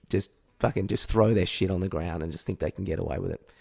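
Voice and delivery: normal talking voice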